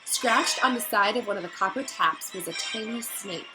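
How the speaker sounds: ordinary delivery, nothing marked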